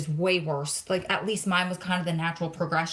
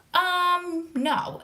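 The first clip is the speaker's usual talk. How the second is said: drawn-out high "um", then a no; retold pushback, pitched up in disbelief; incredulous refusal; 10 semitones higher than usual. Retells rejecting his flying plan.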